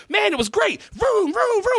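put-on high shouted kid with motor noises; overexcited kid. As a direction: ham up a kid revving a motorcycle. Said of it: "he literally goes like".

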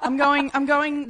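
giggling nervously through words; high, loud nervous laughter; nervous giggle. Real, not acted. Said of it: "That's the most nervous giggle I've ever heard".

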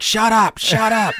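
high, scolding hush; imitated grandmother. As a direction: mimic grandma cutting off the question. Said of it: "my grandmother's like".

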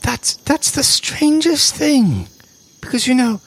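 high, gushing camp diva voice; campy drag queen. Acted. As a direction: greet them like a flamboyant drag queen. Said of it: "She says".

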